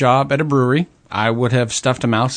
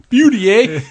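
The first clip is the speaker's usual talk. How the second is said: loud Canadian "Beauty, eh?" with a laugh; higher, laughing Canadian hoser; Canadian hoser accent; 10 semitones higher, 5 dB louder than usual. Retold or imitated. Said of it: "I can't do my impressions today".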